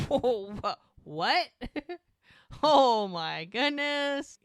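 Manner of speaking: loud acted astonishment, stretched at the end; floored, drawing out the last exclamation; shocked disbelief. Mike learning from Jack that it's twins, loud shocked disbelief.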